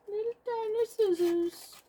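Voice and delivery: put-on very high, teeny-tiny voice; playful tiny voice. Crafter showing off her tiny scissors, playful high voice. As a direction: squeak it playfully, as if tiny yourself.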